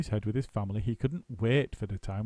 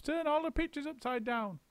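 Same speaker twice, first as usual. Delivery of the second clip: high, urgent orders; high urgent monkey; bossy monkey. A narrator's reading. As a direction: send a bird to keep watch. Mugglewump's orders.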